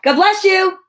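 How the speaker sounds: plain reading voice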